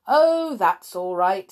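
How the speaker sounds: cheerfully brushing her worry aside; a kindly little man's cheerful reassurance. A narrator's reading. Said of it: "said Pinkwhistle cheerfully".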